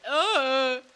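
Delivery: loud, drawn-out groan; loud groan of dread. Real, not acted. Gamer seeing a haunting scene, loud groan.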